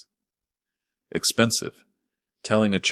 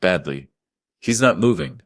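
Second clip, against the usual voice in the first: rising panic; 3 semitones higher, 6 dB louder than usual. Acted. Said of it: "my voice rising".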